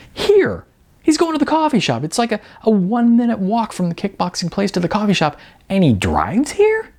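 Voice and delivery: high, fast, disbelieving; incredulous outburst. Acted. Sean incredulous at the star.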